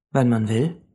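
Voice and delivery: settled, even reply; calm confirmation. A narrator's reading. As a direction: confirm it calmly, without surprise. Man calmly confirms Mia's question.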